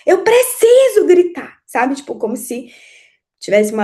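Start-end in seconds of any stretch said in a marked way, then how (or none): 0.1-1.6 high, loud desperate shout; re-enacted yelling. Mother yelling back at her teenage daughter, loud high shout. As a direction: yell back in full voice, much higher. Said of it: "eu gritava tanto".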